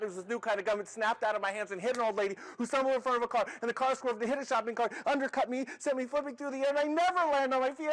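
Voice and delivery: breakneck run-on excuse, climbing in pitch; rushed, building plea ending high and loud; frantic apology. Acted. Frantic rambling apology to manager.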